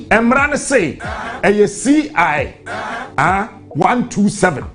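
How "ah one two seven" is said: ordinary talk, nothing marked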